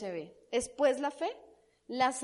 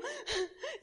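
short laugh; 10 semitones higher, 8 dB quieter than usual. Real, not acted. Laughs after her own mock answer.

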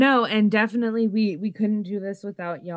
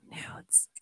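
breathy whispered word; 19 dB quieter than usual. Pretend. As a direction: whisper it like a secret. Whispered teasing.

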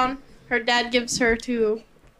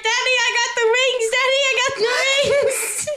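put-on excited little boy; 12 semitones higher, 3 dB louder than usual. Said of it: "He's like".

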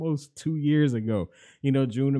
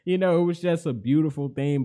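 excited, voice rising; 6 semitones higher, 9 dB louder than usual. Real, not acted. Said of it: "when I'm excited".

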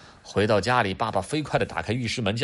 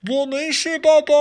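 put-on very high, small child's voice; kid from below; 8 dB louder than usual. Adam answering dad from the drain, high child voice. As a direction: answer from far down the pipe. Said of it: "从下水道底下传来亚当的声音".

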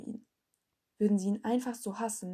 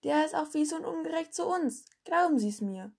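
lauter, höher klagender Schüler; empört über den Lehrer beschweren; aufgebrachter Schüler; 7 semitones higher, 4 dB louder than usual. A narrator's reading. Schüler beschweren sich laut. (aufgebrachter Schüler, laut und höher)

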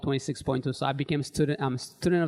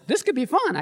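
excited; 13 semitones higher, 4 dB louder than usual. Retold or imitated. Sudden change of heart.

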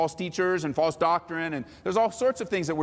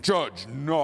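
mocking voice. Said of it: "they'll change their voice most likely".

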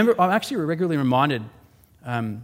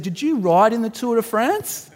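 put-on child's eager question; 9 semitones higher, 5 dB louder than usual. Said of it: "Sebastian said".

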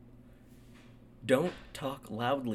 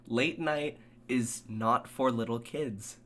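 ordinary delivery

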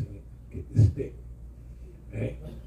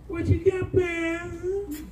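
mock questioner; much slower than usual. Questioner voiced in retold exchange.

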